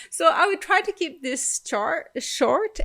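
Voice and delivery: giggling while promising to be brief; laughing through words. Real, not acted. Laughing at her own rambling.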